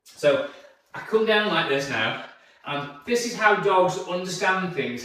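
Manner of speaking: usual speaking voice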